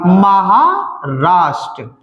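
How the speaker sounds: Maharashtra very slow, split as महा, राष्ट्र; drawn-out dictation. Shown as a demonstration. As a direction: stretch महा, pause, then land राष्ट्र. Slow repeat after asking the class.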